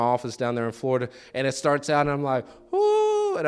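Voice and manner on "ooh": high, drawn-out swoon; awed reaction. Retold or imitated. Preacher replays first hearing a worship song, awed. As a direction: let out a high, appreciative coo.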